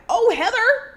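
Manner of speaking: high, loud surprised question; re-enacted astonishment, swooping up high; caught off guard. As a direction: react with startled surprise, pitch jumping up. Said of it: "real caught off guard and surprised".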